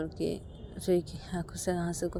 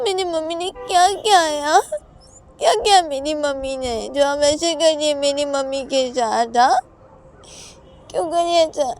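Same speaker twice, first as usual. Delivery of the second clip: put-on high, loud child's whine; accusing little daughter; 9 semitones higher, 11 dB louder than usual. Child blaming papa for mummy's tears, whining protest. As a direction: challenge someone in a distressed child voice.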